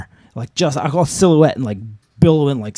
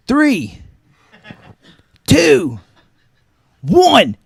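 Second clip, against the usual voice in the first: loud, higher counted-out heave; countdown call; 10 semitones higher, 7 dB louder, much slower than usual. Retold or imitated. Rescuer counts down before pulling her, loud. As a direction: count it down before the big pull. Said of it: "And I go".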